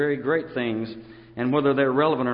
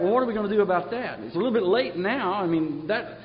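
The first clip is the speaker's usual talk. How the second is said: higher, fast, mock panic; fast and higher, acting out desperate listeners; mock anguished cry; 5 semitones higher than usual. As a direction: rush it out like a panicked crowd. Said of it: "they cried out".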